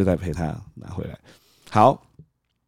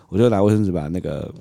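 ordinary talk

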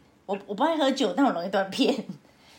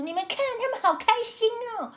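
cheerful pointing out. Retold or imitated. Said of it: "而且我还在那边说".

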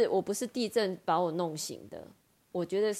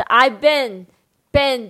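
"been" hit louder and held long; stressed pun word; 5 semitones higher, 14 dB louder, much slower than usual. Shown as a demonstration. Teacher explaining the joke's pun, "been" loud and stretched. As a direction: punch "been" slowly to show the pun. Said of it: "这也是一个双关的笑话啦".

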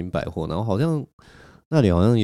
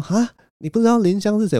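incredulous; 13 semitones higher than usual. Retold or imitated. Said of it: "然后朋友就说".

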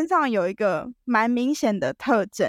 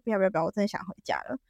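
hurried refusal; 3 semitones lower, 6 dB quieter, much faster than usual. Retold or imitated.

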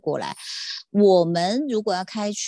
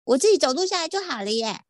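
high, carefree mock of her friend; breezy high-pitched impression of a friend; imitating Dafa; 10 semitones higher than usual. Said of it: "大发说".

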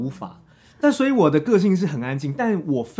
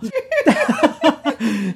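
high laugh; 10 semitones higher, 4 dB louder than usual. Real, not acted. Guest laughs at himself.

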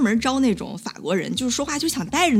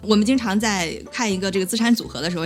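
ordinary delivery

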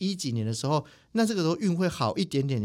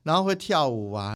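ordinary talk, nothing marked

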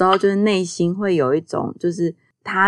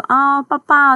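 哄小孩的语气; 9 semitones higher, 6 dB louder, slower than usual. Retold or imitated. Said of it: "就是可能就会说".

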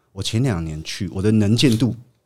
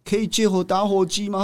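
calling out; 11 semitones higher than usual. Retold or imitated. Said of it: "然后我就喊说".

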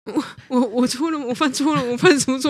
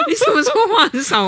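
laughing mock accusation; 13 semitones higher, 5 dB louder than usual. Acting girlfriend, laughing.

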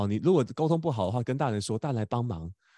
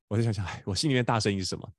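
usual speaking voice

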